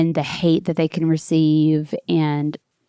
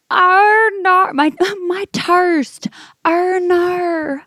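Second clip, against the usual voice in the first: Kiwi "Arnar", much higher, wailing and slow; imitated New Zealand girl; 12 semitones higher, much slower than usual. Retelling the New Zealand girl's panic: high Kiwi wail. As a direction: mimic her crying "Arnar, my toast" in a high wail. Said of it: "She literally goes".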